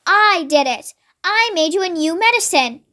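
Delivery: usual speaking voice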